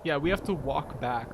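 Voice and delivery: ordinary talk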